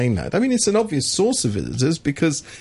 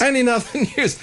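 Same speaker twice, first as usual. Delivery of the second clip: laughing mock announcement; 10 semitones higher than usual. Mocking the topic switch.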